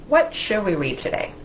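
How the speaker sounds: ordinary talk, nothing marked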